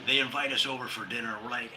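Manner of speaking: normal talking voice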